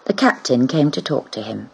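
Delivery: usual speaking voice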